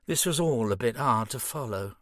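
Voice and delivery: ordinary delivery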